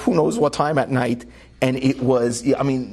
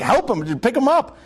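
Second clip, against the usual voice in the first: imitated yell for help, pitched up; retold yell; 10 semitones higher than usual. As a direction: call out urgently, as if retelling a yell. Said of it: "turned to the orderly and I yelled".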